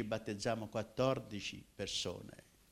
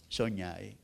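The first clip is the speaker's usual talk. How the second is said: ordinary talk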